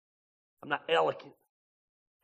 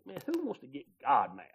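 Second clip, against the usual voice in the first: ordinary delivery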